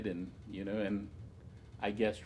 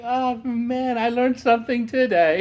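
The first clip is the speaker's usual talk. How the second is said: high, loud mock-amazed exclamation; exclaimed realization; 13 semitones higher, 12 dB louder than usual. New bridge player retelling a lesson learned, loudly amazed. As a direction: cry it out like a humbled student. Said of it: "And I was like".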